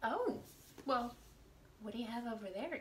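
usual speaking voice

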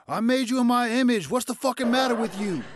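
high, loud exasperated father yelling; disappointed dad. Retold or imitated. God as let-down dad scolding Adam, loud and high. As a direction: berate a son who let you down. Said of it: "like that dad that's always disappointed".

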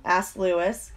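ordinary delivery, nothing marked

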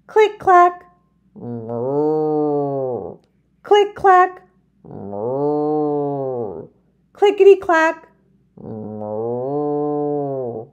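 singsong typewriter and cow; click clack moo. Acted. Typing sound and cow moo.

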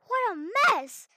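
high, loud slow exclamation; loud exclaim. Shown as a demonstration. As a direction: shout it out in dismay. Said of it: "repeated louder".

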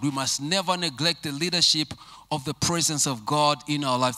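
ordinary talk, nothing marked

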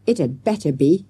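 ordinary delivery, nothing marked

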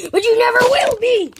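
high, loud mocking yell into a laugh; mock villain taunt and laugh; taunting shout. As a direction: jeer then cackle. Taunting would-be guard.

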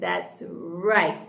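ordinary delivery

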